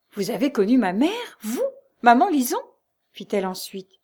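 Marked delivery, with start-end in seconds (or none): 0.1-2.6 high, loud, rising disbelief; stupefied. A narrator's reading. Lucie astonished that maman Lison knew her mother, stupefied. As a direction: question it in astonishment. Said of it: "avec une véritable stupeur".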